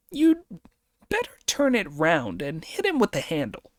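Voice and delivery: high, quick monkey king voice; sharp, high warning as Monkey. Acted. Monkey warns Pig.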